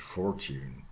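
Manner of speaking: "fortune" with r sounded, FOR-tyoon; American pronunciation. Shown as a demonstration. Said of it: "Or American English".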